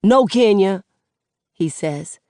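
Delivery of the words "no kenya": angry. Acted. Seven angry.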